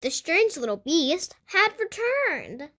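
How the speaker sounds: ordinary delivery, nothing marked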